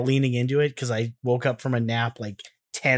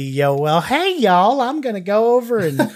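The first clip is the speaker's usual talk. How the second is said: badly faked Texas accent, pitched up; exaggerated Texan; 7 semitones higher, 3 dB louder than usual. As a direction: holler it like a hammed-up Texan. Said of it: "I can't do the accent quite".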